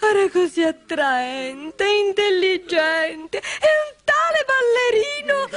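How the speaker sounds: high, slow, drawn-out character voice; Tonto's character voice. Acted. Tonto fondly describes a dancer to friends, high and slow. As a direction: voice a high, lingering storybook figure.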